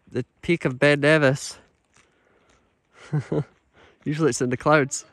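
ordinary delivery

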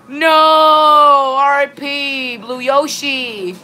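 slow drawn-out mock lament; mock mourning. Mourning a disconnected player, slow mock lament. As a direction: stretch it out like a mock eulogy.

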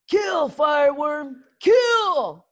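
loud, high, slow shouted command; yelling. A narrator's reading. Snotlout yelling at his dragon Fireworm. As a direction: bellow it at the dragon.

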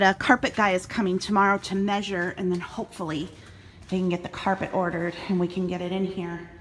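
ordinary talk

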